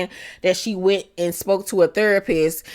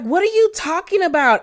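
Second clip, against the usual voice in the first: mock shocked denial; 10 semitones higher, faster than usual. Tamra denies in quote.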